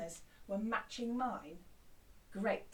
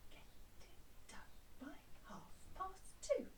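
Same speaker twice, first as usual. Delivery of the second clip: whisper; 5 semitones higher, 9 dB quieter than usual. Shown as a demonstration. Whispered echo of chant.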